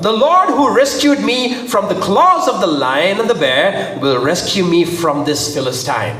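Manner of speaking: high, emphatic declaration settling at the end; dramatic scripture reading. A narrator's reading. David declaring to Saul his trust in God, emphatic. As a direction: proclaim it with conviction.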